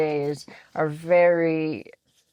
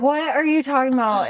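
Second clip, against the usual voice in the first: high, fast disbelief; baffled, can't believe what he said; what on earth are you saying; incredulous; 7 semitones higher, much faster than usual. Retold or imitated. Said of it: "And I'm like".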